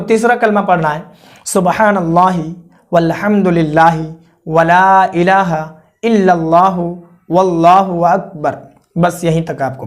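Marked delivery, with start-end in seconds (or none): 1.5-8.6 slow, word by word, each phrase set apart; slow careful recitation. Shown as a demonstration. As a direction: spell the kalma out slowly so they can follow. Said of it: "कितना तक पढ़ना है वो मैं आपको बता रहा हूँ, आप सुनिए". (धीमे-धीमे, एक-एक शब्द अलग करके पढ़ना)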